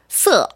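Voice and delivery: a short, falling 서, gliding from 스 into 어; falling fourth tone. Shown as a demonstration. Teacher isolating the second syllable for learners, falling-tone demo. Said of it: "이렇게 발음해 주시면 돼요".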